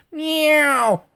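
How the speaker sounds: high cartoon cat meow; imitated meow. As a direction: mew like a startled cat. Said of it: "one of the cats says".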